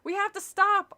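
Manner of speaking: high, loud desperate scream. Acted. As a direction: scream it to the others. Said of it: "I screamed".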